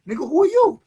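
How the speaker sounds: high, loud retort, retold; acted indignant challenge. Rider answering back a stranger, acted indignant challenge. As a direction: throw the question back at him, acting indignant.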